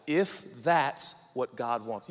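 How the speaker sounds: ordinary talk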